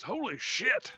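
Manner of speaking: high-pitched cry from far back; distant exclamation. Acted. Game master voices someone back in the distance, high exclamation. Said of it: "back in the distance".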